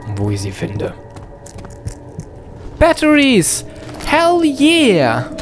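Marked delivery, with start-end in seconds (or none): 2.8-5.3 much higher, triumphant yell; real delighted shout; excited cheer. Real, not acted. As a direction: burst out with real joy. Finds batteries in horror game.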